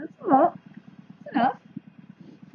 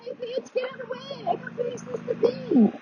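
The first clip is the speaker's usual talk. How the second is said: high, breathy whiny driver; mocking driver voice; 13 semitones higher, 6 dB quieter than usual. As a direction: mimic a rude car driver, squeaky.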